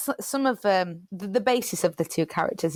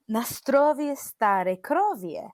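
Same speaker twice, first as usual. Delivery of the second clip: slow, higher, imitating granddad in a Polish accent; sing-song Polish accent, teasing and slow; 4 semitones higher, much slower than usual. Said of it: "and giggling".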